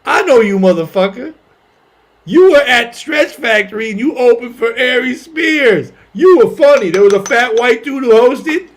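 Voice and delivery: loud, high fan voice; drawn-out mock yell of recognition; imitated excited driver. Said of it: "He goes".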